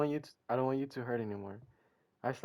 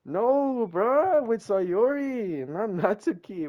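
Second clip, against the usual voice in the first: whiny protest; 8 semitones higher, 5 dB louder, much slower than usual. Real, not acted. Protesting the wrong girl.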